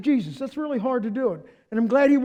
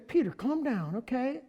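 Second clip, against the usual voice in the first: placating Jesus; 3 dB quieter than usual. Retold or imitated. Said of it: "he'd say".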